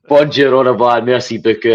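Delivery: put-on Borat accent. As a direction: joke French in a hammed-up Borat accent. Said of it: "I put on a wee Borat accent".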